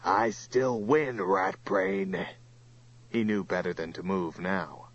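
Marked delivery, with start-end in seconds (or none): 0.0-2.3 slow, drawn-out monster taunt; understated yell in a monster's voice. A narrator's reading. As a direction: gloat slowly as the villainous creature. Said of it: "Turl yelled at Johnny".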